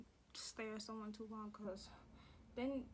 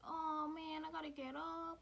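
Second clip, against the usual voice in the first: higher-pitched, drawn-out reluctant whine; mock whining; 6 semitones higher, slower than usual. Acts out reluctantly giving up seat.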